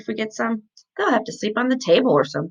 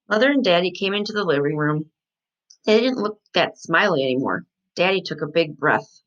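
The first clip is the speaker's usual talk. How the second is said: usual speaking voice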